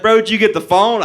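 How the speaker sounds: mock-urgent bro question; buddy yelling. Buddy's voice in retold escape.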